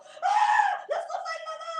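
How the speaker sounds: very high loud shriek; girlish scream. Acted. Papa fleeing from the cat, girlish scream. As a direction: shriek then cry for Mama. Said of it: "screamed like a girl".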